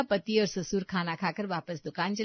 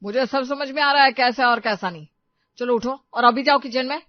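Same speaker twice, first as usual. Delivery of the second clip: loud, firm husband's rebuke; angry scolding; 3 semitones higher, 7 dB louder than usual. Acted. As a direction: order the wife up, loudly. गुस्से में पति पत्नी को डाँटता है. (गुस्से में ज़ोर से डाँटना)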